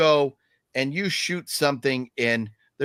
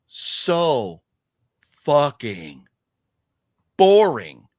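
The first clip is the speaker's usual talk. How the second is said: loud, slow emphasis on boring; BORING blurted out loud; exasperated rant; 2 semitones lower, 6 dB louder, much slower than usual. Real, not acted. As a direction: hammer out how boring it was. Ranting about the movie.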